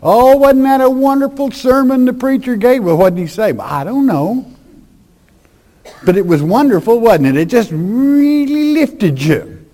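gushing churchgoer. Retold or imitated. Preacher mocking churchgoers who praise a sermon, high gushing voice. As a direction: gush about the sermon like an empty-headed fan. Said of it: "people come out and say".

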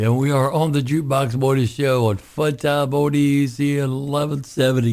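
upbeat radio DJ sign-on; radio announcer. Acted. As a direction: punch it like a morning-show host. Radio host signs back on.

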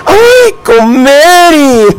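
high, drawn-out whimpering cry of pain; hammed-up tearful howl, slowing on "Comeri"; mock crying wail. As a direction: wail it like a hurt man calling for help. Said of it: "le dijo".

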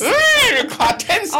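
very high, loud squirm; real creeped-out alarm. Real, not acted. Co-host reacting to tales of crocodiles and piranhas, creeped-out squeal. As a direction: squeal with goosebumps, loudly. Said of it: "You're giving me goosebumps".

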